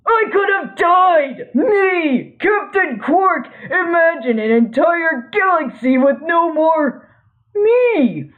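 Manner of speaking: dramatic panic. Acted. Quark panics about dying.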